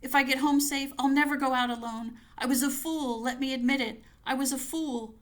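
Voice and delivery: panicked prayer. Acted. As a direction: beg for safety as you run. Fleeing home, praying in panic.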